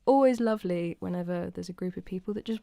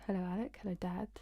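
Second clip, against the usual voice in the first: ordinary delivery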